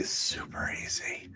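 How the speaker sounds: slow, hushed whisper; drawn-out whispered sales aside. Real, not acted. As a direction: whisper it slowly as reassurance. Echoing the easy sign-up pitch.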